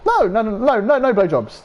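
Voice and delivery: high, drawn-out repeated no; squeaky flustered refusal. Retold or imitated.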